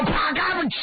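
high smack, crack and hiss; storyteller's suspense sound effects; imitated sudden impacts. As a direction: voice the sudden noises sharply. Imitates sudden noises from wall.

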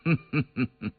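emperor's chuckle; 呵呵笑. A narrator's reading. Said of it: "康熙笑呵呵的". (皇帝呵呵一笑)

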